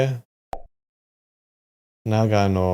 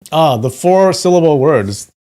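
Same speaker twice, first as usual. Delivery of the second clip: exasperated complaint; 6 semitones higher, 10 dB louder than usual. Real, not acted. Complains about hard names.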